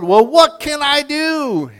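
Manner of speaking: high, loud pleading shout; panicked yell. Retold or imitated. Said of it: "And he yelled".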